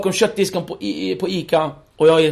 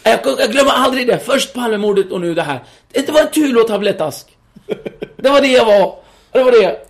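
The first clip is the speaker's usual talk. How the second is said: higher and louder, laughing through the words; 6 semitones higher, 5 dB louder than usual. Real, not acted. Laughing at the detective's line, higher and louder. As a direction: laugh while retelling the absurd accusation. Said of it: "laughing while retelling".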